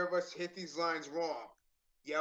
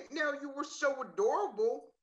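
hammed-up flirtation, higher and lilting up; sexier, flirty; 10 semitones higher, 4 dB louder than usual. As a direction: push the come-on, pitched high. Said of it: "say it more sexy".

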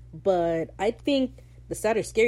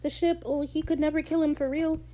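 mocking fans; 9 semitones higher than usual. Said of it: "people are gonna be like".

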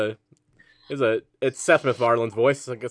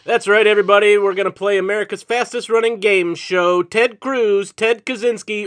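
higher, louder game-show host patter; showbiz voice; 3 semitones higher, 3 dB louder than usual. Acted. Host opening the show for contestants Mary and Dennis, showbiz announcer voice. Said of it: "That's my showbiz voice".

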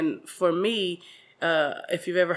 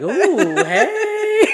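flirty; 12 semitones higher, 7 dB louder than usual. Pretend. Acting flirty reaction to comedy.

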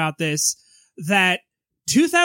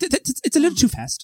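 higher stuttering take; stammering impression; 5 semitones higher, faster than usual. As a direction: stammer it out politely. First take of repeated phrase.

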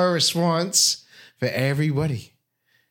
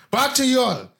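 announcer voice; 11 semitones higher, faster than usual. Acted. Playful announcer handoff.

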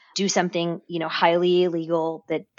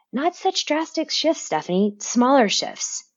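gentle self-advice; 6 semitones higher than usual. Retold or imitated. Quoting her own inner advice.

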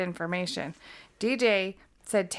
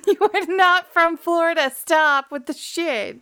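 laughing, higher, louder mock scolding; 9 semitones higher, 8 dB louder than usual. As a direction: laugh while calling out the fake. Laughing mock call-out of accent.

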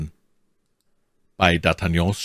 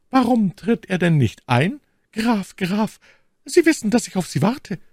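much higher, trembling lady's voice. Acted. My Lady calls the count into her dark room, trembling. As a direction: call him in with a quivering high voice. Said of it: "mit zitternder Stimme".

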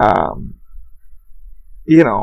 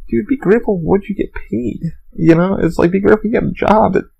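ordinary talk, nothing marked

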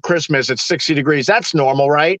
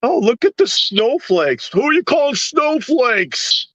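mock offended snowflake; 6 semitones higher than usual. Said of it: "Remember when you could say snowflake".

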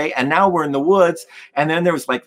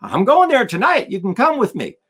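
hearty higher-pitched voice for the guy; retold music store guy; 8 semitones higher than usual. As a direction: play the guy, pitch swooping well up. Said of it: "And the guy goes".

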